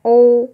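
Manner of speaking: a drawn-out loud ओ sound; vowel demo. Shown as a demonstration. Teacher demonstrating the vowel sound ओ. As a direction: sing out the vowel ओ loudly. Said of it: "vowel sound demo".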